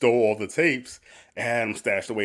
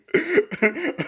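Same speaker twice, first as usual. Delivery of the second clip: high squealing laugh; 4 dB louder than usual. Real, not acted. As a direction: burst into a shrill laugh. Laughing at own story.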